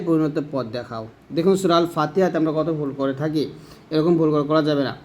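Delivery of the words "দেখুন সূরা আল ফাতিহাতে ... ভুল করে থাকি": ordinary delivery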